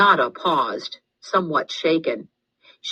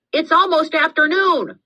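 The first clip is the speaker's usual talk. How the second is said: much higher, louder heckling shout; yelling; 13 semitones higher, 4 dB louder than usual. A narrator's reading. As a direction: call it out across the room to mock her. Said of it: "Carlos yelled".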